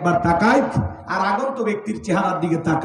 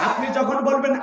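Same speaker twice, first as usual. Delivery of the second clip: normal talking voice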